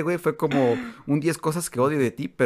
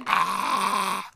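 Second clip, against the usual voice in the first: crowd cheer; 6 semitones higher than usual. Retold or imitated. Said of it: "todos así gritando".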